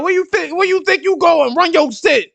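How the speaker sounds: high hammed-up thug voice; playful tough-guy stickup; mock tough guy. Mock tough stopping Fado.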